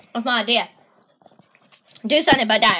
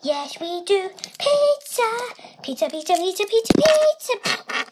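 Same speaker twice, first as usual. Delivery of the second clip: ordinary talk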